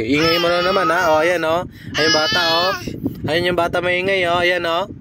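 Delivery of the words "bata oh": put-on much higher, teasing baby-talk voice; squeaky baby talk. Mother teasing her angry baby in baby talk.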